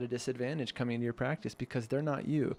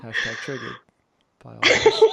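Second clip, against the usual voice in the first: mock outrage, the last words much louder; mock triggered; 12 dB louder than usual. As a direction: play up being triggered as a joke. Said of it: "I'm only triggered because".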